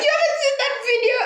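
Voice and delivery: high, fast disbelief; can't believe they missed it; incredulous. Retold or imitated. Girl amazed her family missed the meme, high fast disbelief. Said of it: "And I'm like".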